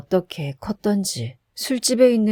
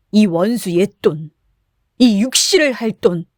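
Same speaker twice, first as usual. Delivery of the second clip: louder, bitter curse; cursing the money; 4 dB louder than usual. A narrator's reading. Drunk Kim curses his money as he throws it, enraged. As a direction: hurl the words at the money.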